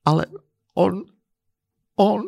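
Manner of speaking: halting, broken-up words with pauses; a touch of a sob between words; falter, word by word, tearfully; sobbed. Acted. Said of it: "zavzlikala žena".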